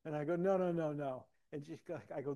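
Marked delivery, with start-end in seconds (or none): 0.4-1.3 quick retold string of protest, louder and higher; mock-flustered backpedal; flustered refusal.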